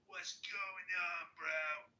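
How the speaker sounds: very slow, loud, high fake tough-guy greeting; mock gangsta. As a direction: stretch it out like a poser. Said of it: "you don't have to be like".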